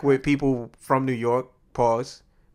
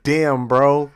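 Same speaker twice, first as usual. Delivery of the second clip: louder mock exclamation; retold exclamation; 6 dB louder than usual. Friend acting out a boast about cars, mock exclamation. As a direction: punch it out like a quoted boast.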